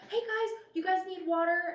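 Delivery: put-on high, sweet older woman saying honey; sugary high lady's voice; doorstep lady voice. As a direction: coo it like a kindly neighbor at her door. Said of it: "And she's like".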